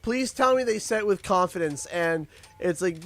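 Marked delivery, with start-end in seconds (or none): none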